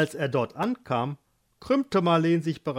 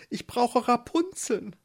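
higher, pained pleading woman's voice; craving, desperate young wife; suffering wife; 9 semitones higher than usual. Acted. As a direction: beg him through the cramps. Marleen cries out.

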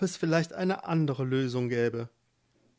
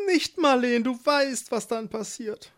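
higher, urgent husband's voice; a worried young husband; warning husband; 9 semitones higher than usual. Acted. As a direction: stop her before it's too late. Eduard warns his wife.

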